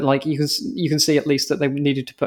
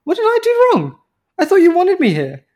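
hurt bewilderment; 13 semitones higher than usual. Pretend. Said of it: "I'd still be like".

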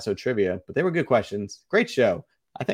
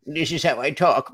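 silly put-on voice; 4 semitones higher than usual. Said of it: "I talk like this all the time".

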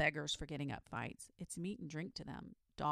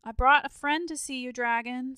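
loud, higher call to the dragon; rather loud; 7 semitones higher, 13 dB louder than usual. A narrator's reading. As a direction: call out to wake him. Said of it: "said the boy rather loud".

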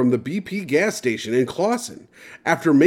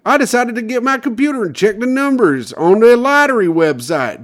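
Michigan lottery winner impression; 9 semitones higher, 4 dB louder than usual. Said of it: "my impression of what I think he sounds like".

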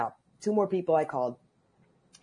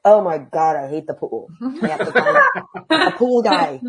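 laughing exasperation; 3 semitones higher, 7 dB louder than usual. Retold or imitated. Exasperated self-quote about pool.

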